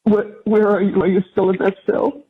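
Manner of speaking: ordinary delivery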